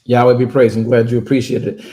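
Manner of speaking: loud, low, preacher-like proclamation; booming praise. Real, not acted. Host answering caller, loud and preacherly. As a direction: boom it out like a pulpit.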